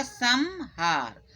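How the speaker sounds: संहार said slowly as "sammm-haar", with a held m; word split into sounds. Shown as a demonstration. Teacher splitting the word for pupils, संहार as slow "sam-haar". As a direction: break संहार in two, humming the m. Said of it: "वर्ण विच्छेद करेंगे तो क्या हो जाएगा?".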